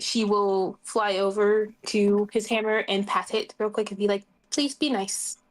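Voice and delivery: ordinary talk, nothing marked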